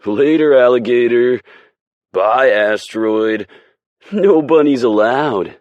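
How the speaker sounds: loud, high rowdy hollering; jeering shouts. Acted. Hockey guys heckle Aspen leaving, loud and rowdy. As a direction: heckle her out the door. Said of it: "Several shout and wave bye".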